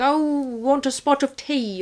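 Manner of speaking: high, slow mock-British voice; hammed-up British tea-time accent; attempted British accent. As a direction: imitate a bad actor's British accent. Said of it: "trying to do a British accent".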